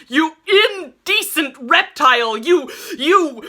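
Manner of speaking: high, loud rage sputtering out; enraged shout trailing into a choke; furious insult choking off. A narrator's reading. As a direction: spit the insult until words fail. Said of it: "Norman choked for a moment".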